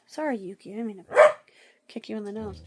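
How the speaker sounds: ordinary delivery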